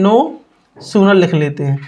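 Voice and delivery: "no" then a pause, then "sooner" said low and slow; teacher dictating "no sooner" word by word to the class; slow dictation. Shown as a demonstration. The teacher dictates "no sooner" slowly so students can write it. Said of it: "तो पहले लिख ले".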